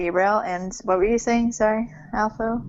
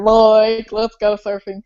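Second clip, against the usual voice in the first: surfer voice; 6 dB louder, slower than usual. Pretend. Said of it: "I sound like a friggin' surfer".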